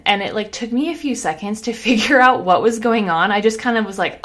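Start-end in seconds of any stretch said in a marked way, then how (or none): none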